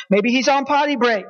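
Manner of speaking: normal talking voice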